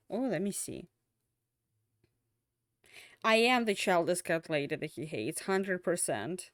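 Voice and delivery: ordinary talk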